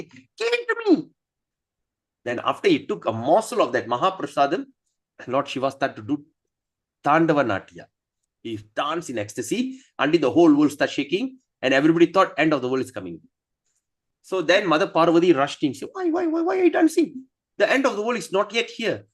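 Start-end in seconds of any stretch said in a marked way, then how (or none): 0.0-1.1 eager demand. Acted. Shiva eager for prasadam. 15.9-19.1 high, alarmed why-why-why; alarmed Parvati. Acted. Parvati rushing in to stop Shiva's dancing, high and alarmed.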